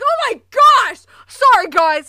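loud high embarrassed apology; flustered shout. Retold or imitated. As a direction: blurt it out, mortified. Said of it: "he's like".

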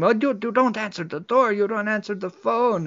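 mild acted-out telling-off; mock yell. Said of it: "Paquita yells at him".

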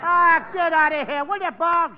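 an annoyed comic yell at Bugs; exasperated shout. Acted. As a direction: throw him off with a loud groan. Exasperated brush-off.

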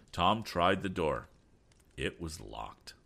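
plain reading voice

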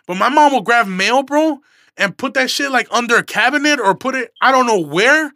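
high, loud, exasperated venting; loud, high, exasperated complaint; worked-up rant. Real, not acted. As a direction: let it build into an outraged rant about mom. Heated rant about mom hiding mail.

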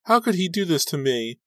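put-on high, anguished girl's voice; tearful wail. A narrator's reading. Rachel asking about the boyfriend who left her, tearful. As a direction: wail the question high, close to tears. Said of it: "welling up with fresh tears".

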